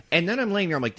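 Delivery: usual speaking voice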